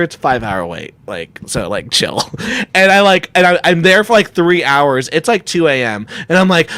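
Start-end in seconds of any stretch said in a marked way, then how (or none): 0.0-2.3 lower, offhand brush-off; bored staff. Retold or imitated. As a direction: shrug it off, nothing urgent. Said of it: "they go".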